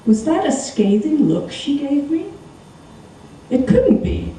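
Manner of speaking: plain reading voice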